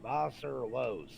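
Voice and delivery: put-on slow, loud Kentucky-accented German; imitated thick Kentucky accent; Glenn Asher. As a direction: stretch the German into a Kentucky drawl. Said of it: "had the thickest accent".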